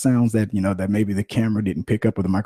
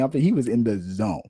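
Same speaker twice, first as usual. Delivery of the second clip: normal talking voice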